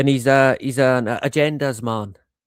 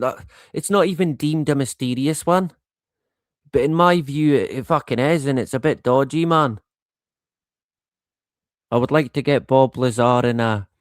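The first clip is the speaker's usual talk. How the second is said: usual speaking voice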